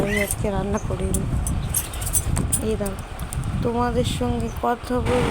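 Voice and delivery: hushed, keep it down; speaking softly. Real, not acted. Mother speaking softly to viewers so her sleeping daughter won't wake. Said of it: "আস্তে আস্তে কথা বলছি".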